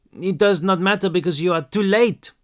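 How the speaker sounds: put-on young French girl speaking careful English; French accent. A narrator's reading. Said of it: "she said in broken English".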